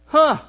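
a loud, high, dismissive huh; wife's dismissive huh. Retold or imitated. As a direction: mimic the wife brushing him off with one loud syllable. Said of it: "it goes something like this".

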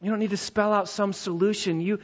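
ordinary talk, nothing marked

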